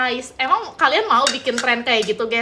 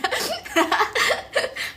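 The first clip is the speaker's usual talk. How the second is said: high bashful giggle; 9 semitones higher than usual. Real, not acted. Tertawa malu. (tawa kecil tinggi)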